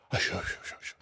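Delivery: rapid whispered shushing; hushing. Retold or imitated. Friends hushing a bickering couple, whispered. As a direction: whisper it repeatedly to quiet a squabble. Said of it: "Joey and Noni hush them".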